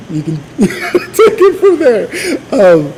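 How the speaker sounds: laughing through the words, pitch jumping up; real laugh breaking into the line. Real, not acted. Pastor laughing at his own joke to the congregation, laughing.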